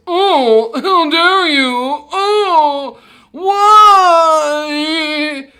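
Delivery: loud, high, slow pained cry; wounded wailing. Acted. Injured prince wails at the princess, loud and high. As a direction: wail over the cut cheek.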